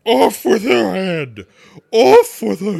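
high, very loud, drawn-out order; furious scream. A narrator's reading. Furious Queen orders an execution, screaming. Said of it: "began screaming".

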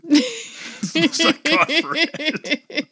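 laughing. Real, not acted. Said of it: "laughing off the impression".

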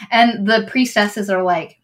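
normal talking voice

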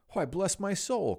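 taken aback by the uncle at the door; startled cry. Acted. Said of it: "cried Fred".

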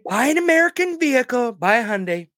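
high-pitched presidential sales pitch; hammed-up, high politician impression; Donald Trump. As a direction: push the product in a put-on politician voice. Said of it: "We'll do it in Trump".

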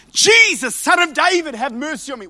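high, loud cry fading to a plea. Retold or imitated. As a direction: call out desperately to Jesus. Blind Bartimaeus crying out.

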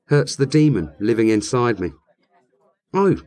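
ordinary talk, nothing marked

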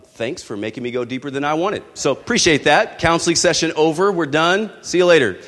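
brusque brush-off. Retold or imitated. Brushing off friend's probing question.

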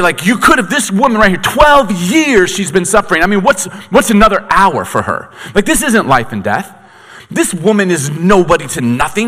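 high, loud, outraged rant; furious dad demanding answers; indignant father. Retold or imitated. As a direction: confront Jesus as the grieving father. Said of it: "if I'm Jairus".

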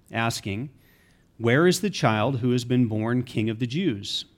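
normal talking voice

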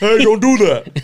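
retold guard's loud, slow warning; security guard. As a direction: imitate a guard calling out a drawn-out order. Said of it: "And the fucking security guard".

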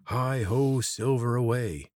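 high and loud, slow rallying cry. Acted. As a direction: call it out like a cheer. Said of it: "Corvin raised his voice".

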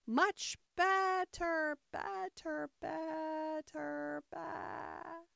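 high, very drawn-out squeak fading into a bleat; bleating like a sheep. A narrator's reading. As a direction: stretch "better" into a sheep's baa. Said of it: "The last word ended in a long bleat".

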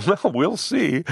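acted laugh running into the words; amused, laughing reply. A narrator's reading. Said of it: "He laughed".

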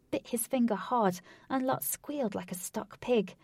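usual speaking voice

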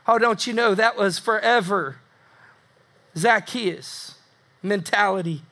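ordinary talk

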